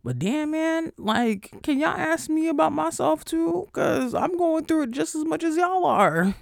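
whiny complaint. Real, not acted. Pleading with friends to ask about her, high and whiny. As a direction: whine it at the friends who never ask.

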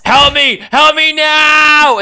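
high cry building to a long drawn-out scream; hammed-up cry for help, stretching the last word; mock scream. Logan calls the professor for help, in a hammed-up scream. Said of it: "then he screams".

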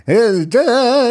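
high-pitched cartoon grumble; cartoonish angry voice. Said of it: "he does the little voice inflection like it's from a cartoon".

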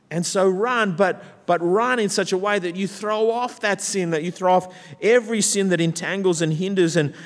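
high, urgent exhortation; rousing preaching. Real, not acted. Preacher urges congregation to throw off sin, preaching rousingly.

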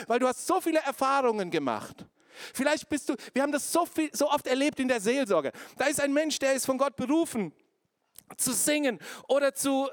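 high, loud, driving preacher voice; preaching at full force. Acted. Preacher rousing the church about the enemy, full force. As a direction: hammer it home to the congregation.

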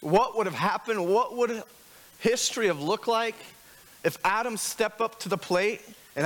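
preaching at full force. Acted. Pastor rallying congregation about courage, preaching hard. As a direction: lift the room with rising rhetorical questions.

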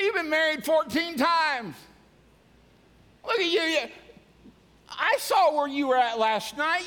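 high, loud jeering accusations; mock the listener like the devil; Satan's accusing voice. Preacher voicing Satan accusing a sinner. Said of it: "Satan whispers into your ear and says".

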